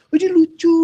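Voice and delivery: put-on high, loud gushing over a baby; mock cooing. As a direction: coo it in an exaggerated high voice. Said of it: "nah, jadi bukan". (pura-pura gemas, suara tinggi)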